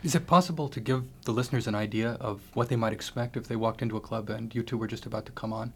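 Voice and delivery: ordinary talk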